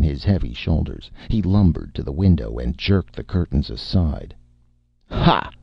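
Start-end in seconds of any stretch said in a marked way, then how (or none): 5.1-5.5 sinister, higher-pitched exclamation. A narrator's reading. Director finding Martin behind the curtains, sinister. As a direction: pounce with a villain's "Ha". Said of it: "said in a sinister voice".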